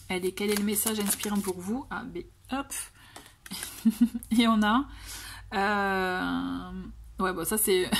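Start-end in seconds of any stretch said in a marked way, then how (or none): 3.4-4.3 short chuckle. Real, not acted. Card reader amused by the card just drawn, short chuckle. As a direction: chuckle lightly to yourself.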